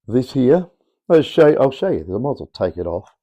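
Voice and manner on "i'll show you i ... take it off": ordinary talk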